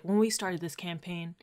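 quiet, breathy whisper of a furious boss; mock whisper; hushed whisper. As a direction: hiss it low behind closed doors. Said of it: "he's like".

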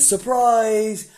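loud, high "Surprise!"; surprise shout. Retold or imitated. Revealing himself to his family, loud high shout. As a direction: throw the door open and yell it.

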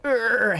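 high straining grunt of effort. Retold or imitated. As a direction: grunt like you can't open the jar. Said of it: "He's like".

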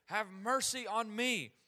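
high, loud plea to be heard; acted shout for mercy; crying out. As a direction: call out over a noisy crowd, stretching the last words. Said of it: "he cries out".

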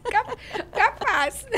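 laughing demo of "capaz"; mineiro capaz. Shown as a demonstration. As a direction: drawl "capaz" slowly. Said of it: "O meu já tá chiado".

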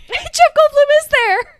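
high, loud, slow laugh-cry; laughing squeal. Real, not acted. Echoing her friend, cracking up into a high squeal. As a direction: echo it while cracking up.

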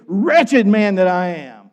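loud, high, slow cry of wretchedness; anguished outcry. Acted. Preacher voices Paul's lament to congregation, anguished outcry.

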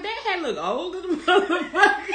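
high, laughing mock outrage; laughing disbelief. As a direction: crack up while roasting the hat. Amused retelling of her reaction.

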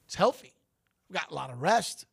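normal talking voice

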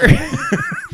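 high squeaky laugh; a little high giggle. Real, not acted. Laughing at own joke.

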